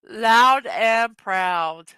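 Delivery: loud and proud, slow and stretched; drawn-out emphasis. Acted. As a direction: lean on every word, savour it. Reading a meme title aloud.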